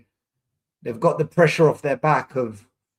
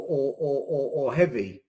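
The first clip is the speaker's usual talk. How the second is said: ordinary talk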